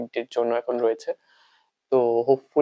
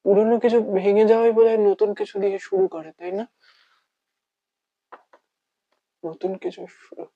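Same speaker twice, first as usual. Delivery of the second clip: slightly raised, halting monologue with long pauses; heartbroken lover; 8 semitones higher than usual. Acted. Lover resolving to start anew, wavering and heartbroken. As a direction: struggle to convince yourself, then let it sag.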